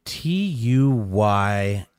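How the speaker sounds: slow spelling. Real, not acted. Co-host working out a listener's name, slow spelling. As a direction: spell it out slowly, pausing between letters.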